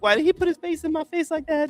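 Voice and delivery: very high falsetto impression. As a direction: ask in a mimicked pop star's high, put-upon voice. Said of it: "he does his impression of [name]".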